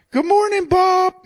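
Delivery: high squawky parrot voice. Retold or imitated. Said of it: "he'd wake up every morning to".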